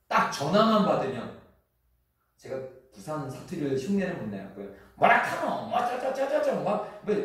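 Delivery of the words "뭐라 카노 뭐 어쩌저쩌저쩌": high, loud mock Busan phone talk; Busan dialect. Pastor imitating his Busan friend on the phone, hammed-up Busan accent. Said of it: "뭐 방언처럼 막 말해요".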